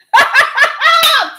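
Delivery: big burst of high laughter; loud laugh. Real, not acted. Preacher to congregation, a loud laugh.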